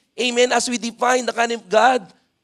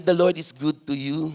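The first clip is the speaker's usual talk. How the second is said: lower, softer aside; telling a seatmate; 5 semitones lower, 5 dB quieter than usual. Shown as a demonstration. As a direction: say it softly as if to one neighbour. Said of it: "Tell to your seatmate".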